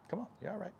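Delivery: high, soft coaxing to a horse; soothing coax. Real, not acted. Massage therapist coaxing the nervous horse, high and soft.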